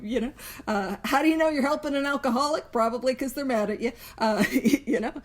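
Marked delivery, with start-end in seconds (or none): 4.2-5.3 laughing. Real, not acted. Laughs at own joke.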